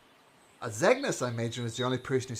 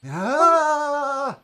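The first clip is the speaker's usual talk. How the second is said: long high scream, very loud; fleeing scream; 13 dB louder than usual. Acted. Game master voicing the runaway character, loud high scream. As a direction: let out a drawn-out runaway scream. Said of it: "Running away".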